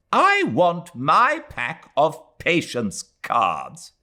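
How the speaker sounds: put-on a stubborn old mother insisting; petulant old woman. A narrator's reading.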